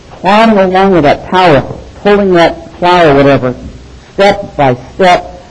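ordinary talk, nothing marked

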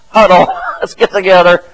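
loud coach imitation breaking into laughter; laughing coach's call. Coach gathers a fragmented team, loud and laughing. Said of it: "like a coach bringing a team".

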